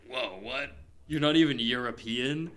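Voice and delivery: taken-aback, puzzled question; baffled mock objection; mock disbelief. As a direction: react as if thrown off, rising at the end. Acting out confusion at American accents.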